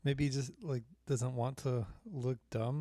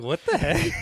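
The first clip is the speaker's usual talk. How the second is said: laughing disbelief, louder and higher; 8 dB louder than usual. Real, not acted. Friend retelling a shocking interview clip, laughing. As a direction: blurt it out through a laugh.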